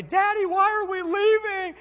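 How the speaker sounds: high, slow child's complaint, a mild mock yell; kid's mock yell. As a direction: drag it out like an upset little boy. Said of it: "[name]'s yelling at me".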